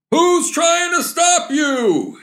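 very high, drawn-out mock shout. Father shouting back at his departing son. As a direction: holler it slowly at a high pitch. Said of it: "shouted his father".

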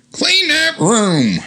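high, slow nagging mother; bossy mom voice. Pretend. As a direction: drag it out like a shrill-toned scold. Voicing mom Cheryl in drawing.

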